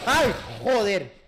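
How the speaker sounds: sudden loud scared cry; startled yell. Real, not acted. Jump scare in horror game.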